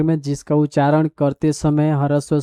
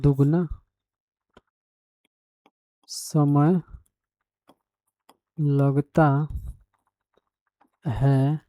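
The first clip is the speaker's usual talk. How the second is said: slow, word-by-word dictation, softer; 4 dB quieter, much slower than usual. Shown as a demonstration. Teacher dictating the definition to students, slow and measured. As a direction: spell it out slowly so students can copy.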